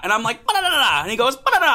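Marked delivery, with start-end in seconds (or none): 0.5-1.0 high, loud babbled comeback; mock yelling. Driver reenacting his yelled reply, mock yelling. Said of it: "going back and forth yelling". 1.4-1.7 very high, very loud gibberish; mock yelling. Driver imitating the stranger yelling back, mock yelling. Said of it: "going back and forth yelling".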